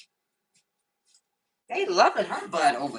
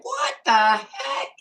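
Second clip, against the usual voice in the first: retold amazement; 7 semitones higher, 5 dB louder, much slower than usual. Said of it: "I was like".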